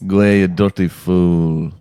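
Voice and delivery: put-on Mallow, County Cork accent; demonstrating a Mallow accent. Shown as a demonstration. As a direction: say it like someone from Mallow. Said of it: "in a Mallow accent".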